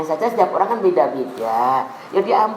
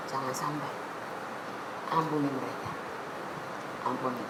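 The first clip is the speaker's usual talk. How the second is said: hushed plea for mercy; barely voiced, begging forgiveness; soft pleading prayer; 7 semitones lower, 18 dB quieter than usual. Preacher voicing a prayer for mercy, hushed.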